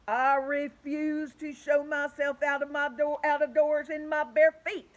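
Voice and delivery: loud, slow prim refusal; fussy centipede. Acted. The centipede refuses the others, loud and prim. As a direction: put your foot down primly.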